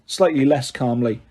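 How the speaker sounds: usual speaking voice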